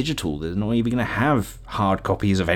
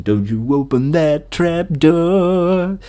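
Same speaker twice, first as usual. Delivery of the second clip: slow, high, drawn-out cartoon monster line; hammed-up cartoon villain warning; mock monster voice; 7 semitones higher, much slower than usual. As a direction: growl it like the Trapdoor creature. Quoting Trapdoor cartoon line.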